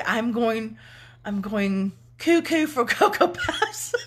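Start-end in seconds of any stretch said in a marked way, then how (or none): 2.2-3.9 high, giggly punchline; words bursting into laughter; laughing. Real, not acted. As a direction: crack up halfway through the phrase. Joking, breaks into laughter.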